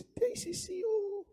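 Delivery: tiny falsetto gatekeeper; thin high voice. Acted. Gatekeeper answering a cowboy who seeks work, thin high voice. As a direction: squeak it out soft and very high. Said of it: "esse camarada fala fino".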